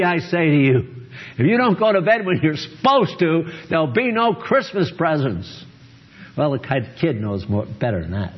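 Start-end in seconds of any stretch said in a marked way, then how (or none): 0.0-5.7 stern parent. Retold or imitated. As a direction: put your foot down with the kid. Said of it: "Imagine if you said to your child".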